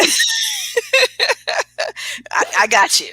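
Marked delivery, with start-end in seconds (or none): 0.3-2.2 real squealing laugh; high shrieking laugh. Real, not acted. Laughing at kid actor request.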